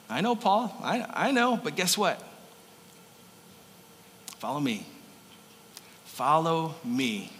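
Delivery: a hint of Jesus, hushed and kind; tender Jesus. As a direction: gently draw him in. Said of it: "Jesus would say to Paul".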